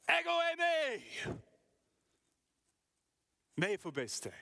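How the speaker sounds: high, loud, ringing call; shouted over the storm. Shown as a demonstration. Jesus shouting to his frightened disciples over the sea. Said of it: "But more like".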